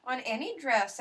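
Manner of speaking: high, sugary condescending sweetie; sales lady voice. Retold or imitated. Imitating the patient sales lady speaking to a fussy customer, high. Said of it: "She was like, "Well, sweetie".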